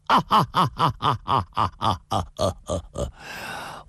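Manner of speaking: long slow ringing hero's laugh, unafraid; defiant loud laugh. A narrator's reading. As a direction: throw your head back and laugh at them. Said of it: "纵声大笑道". (纵声大笑)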